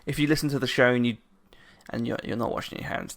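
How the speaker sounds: usual speaking voice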